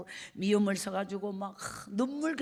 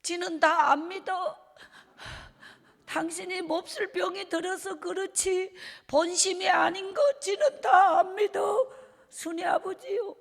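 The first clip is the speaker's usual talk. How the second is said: high, loud crying cry-out of a wife forgiving her husband; mother forgiving the father, voiced in tears; tearful plea; 9 semitones higher, 4 dB louder than usual. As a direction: sob it out pleading, rising to a crying shout. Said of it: "눈에 눈물을 흘리면서 이렇게 말하는 거예요".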